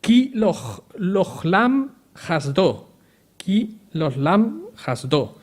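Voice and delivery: "lochlam hasdo" slow, word by word; Hebrew response. Shown as a demonstration. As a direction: spell out "ki lochlam hasdo" slowly. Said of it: "Pues contestaban". ("ki lochlam hasdo" despacio, sílaba a sílaba)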